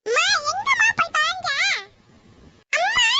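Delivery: high-pitched yells for mother; calling out loudly. Acted. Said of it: "என்னத்துக்கு கத்திக்கிட்டு இருக்க".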